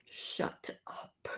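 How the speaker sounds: hushed, breathy whisper under the breath; whispered shut up. Retold or imitated. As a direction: whisper it so nobody hears, annoyed. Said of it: "and I was just like".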